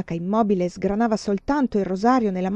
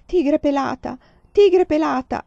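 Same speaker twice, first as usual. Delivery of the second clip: high, rising call, louder the second time; mother calling her child; 7 semitones higher, 6 dB louder than usual. A narrator's reading. Nun in trance calls a childhood nickname, motherly calling cry. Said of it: "voce simile a quella di una madre che chiama il figlioletto".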